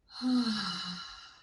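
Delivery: long, audible sighing exhale. Shown as a demonstration. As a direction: let a full breath go on an open "ah". Modeling a deep breath.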